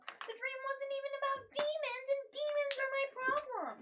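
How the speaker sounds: high, loud frustrated protest; heated, insistent complaint; exasperated outburst. Acted. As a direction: argue back with voice pitched right up. In-character argument about demons.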